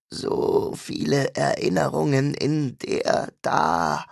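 very slow, breathy, hungry creature rasp; hungry demon rasp. A narrator's reading. The demon craves Joan's memories, slow ravenous rasp. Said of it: "Die Stimme klang kratzig".

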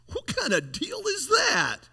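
incredulous laugh, pitch leaping up. Real, not acted. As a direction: laugh it out in disbelief. Laughing at God's deal.

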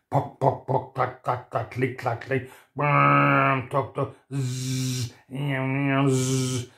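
drilling noises. A narrator's reading. Imitated hammering and drilling.

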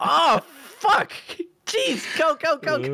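frantic shout to escape; panicked yelling. Retold or imitated. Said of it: "And I'm just like".

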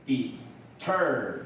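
"deter" as de-TER, second syllable drawn out; short de, long TER in "deter"; stress on second syllable. Shown as a demonstration. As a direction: model "deter" with weight on the end. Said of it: "重音在第二节".

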